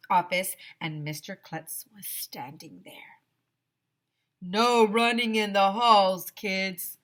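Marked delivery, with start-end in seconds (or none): 4.4-7.0 louder, gruff principal voice; stern principal shout. Acted. Mister Klutz shouting at the kids chasing the zombie. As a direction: scold the kids running past the office. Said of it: "he shouted at us".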